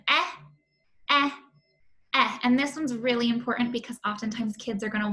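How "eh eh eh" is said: "eh" with lips pulled right back; short e sound. Shown as a demonstration. Teacher showing kids the elephant eh sound. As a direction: clip "eh" short with spread lips. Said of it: "to say that eh sound".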